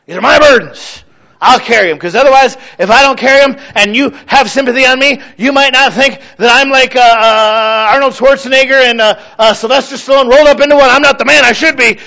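mock-stubborn, refusing to let anyone help; stubborn refusal. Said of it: "I'm guilty of that".

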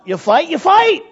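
high and loud; loud, forceful saying; emphatic rule. Retold or imitated. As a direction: bark out the old rule. Preaching dad's rule.

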